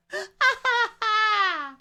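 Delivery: loud, very high cackle of laughter; shrieking laugh. Real, not acted. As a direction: burst out laughing at your own joke. Cracks up at own joke.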